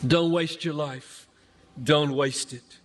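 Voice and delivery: usual speaking voice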